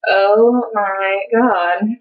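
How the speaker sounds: very slow, drawn-out dismay; mortified. Retold or imitated. Daughter after dad's announcement, retold slow mortification. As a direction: stretch every word in embarrassment.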